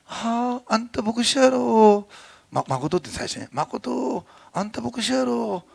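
cheerful Osaka mother teasing gently; mother in Osaka dialect. Retold or imitated. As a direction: tease him like an Osaka mom who's cheerful about it. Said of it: "って言って、大阪弁でね".

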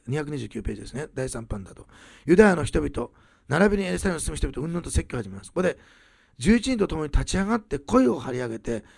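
ordinary delivery

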